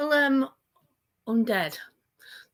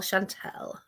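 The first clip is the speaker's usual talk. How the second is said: 1 whisper; 8 dB quieter than usual. Real, not acted. Taunting whisper of the name.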